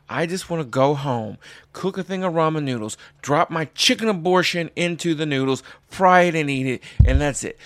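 shouting at the guy in line to go away; angry rant. Retold or imitated. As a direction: yell him down, fed up. Ranting at PETA guy.

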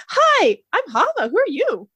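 high, loud, eager greeting; excited Hava. Acted. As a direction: introduce yourself with bubbly eagerness. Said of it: "So she's gonna be like".

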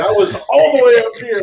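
high, loud burst of excitement; excited high. Retold or imitated. Friend recalls being thrilled by Budweiser, high excitement. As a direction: send it way up, loud.

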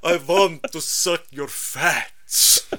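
slow, put-on Dracula drawl; vampire voice. Dracula seducing you with a slow vampire voice.

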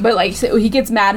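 usual speaking voice

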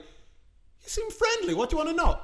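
mock-innocent eagerness; gullible suspect. Gullible suspect answering good cop.

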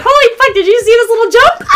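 high, loud excited shriek; excited squeal. Retold or imitated. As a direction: squeal with thrilled disbelief. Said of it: "'cause we're like".

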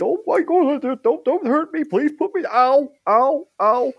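high, panicked begging; terrified pleading. Acted. Turtle pleading with trolls not to hurt him, terrified. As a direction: squeal and yelp in mock agony. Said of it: "You can hear this".

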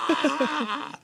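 high-pitched hammed-up "Ah!" yell; comic creature scream of rage; mock angry scream. Voicing a game's axolotl getting mad, mock scream. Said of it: "he's getting mad".

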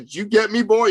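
high, pointed demand to his son; stern dad. Retold or imitated. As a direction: lean in like a strict father. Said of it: "I said".

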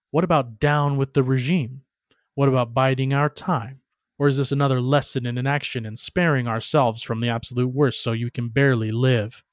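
ordinary delivery

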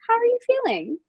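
high, sugary nurse voice; a student nurse asks an amputee gently how she feels; sweet check-in. Retold or imitated. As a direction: ask it with careful over-sweetness. Nurse asking gently, retold.

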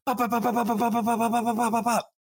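gunshots mimicked high and fast; rapid high gunshot noises. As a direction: rattle off pop pop pop like shots. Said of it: "she's like".

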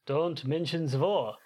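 put-on slow hammy German accent, z for th; bad German accent. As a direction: say it like a cartoon German officer. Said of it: "bad German accent".

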